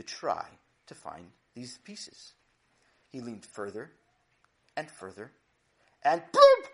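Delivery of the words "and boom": a sudden, very high, loud burst; dramatic boom. A narrator's reading. Narrator marking Sammy's fall, loud and sudden. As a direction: slam the word out like a crash.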